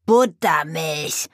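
slow, drawn-out raven screech. Acted. Raven Abraxas screeching at the witch, slow shriek. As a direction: screech the word out in disbelief. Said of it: "kreischte Abraxas".

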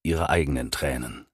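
ordinary delivery

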